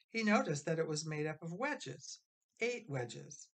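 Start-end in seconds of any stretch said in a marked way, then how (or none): none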